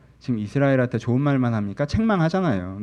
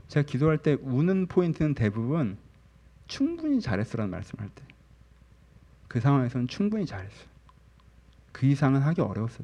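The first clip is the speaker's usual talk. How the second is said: ordinary talk, nothing marked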